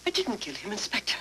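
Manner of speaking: ordinary talk, nothing marked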